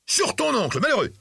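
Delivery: very high, scandalised exclamation; outraged cry. A narrator's reading. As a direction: cry out in shocked reproach, pitched right up. Uncle cries out at raised hand. (exclamation scandalisée, très aiguë)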